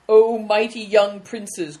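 loud, slow mock-grand address; mocking cry. A narrator's reading. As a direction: hail them grandly in jest, drawn out. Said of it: "cried another".

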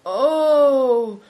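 long, loud, high groan; theatrical groan. A narrator's reading. Hailstone lying hurt by the carriage, groaning theatrically. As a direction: heave a drawn-out moan. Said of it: "heaving a groan".

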